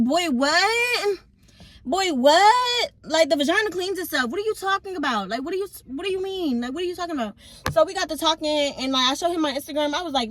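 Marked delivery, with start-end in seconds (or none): 0.0-7.3 high, loud mock disbelief; incredulous scoff. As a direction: scoff at him like he's talking nonsense. Scoffing at his STD claim.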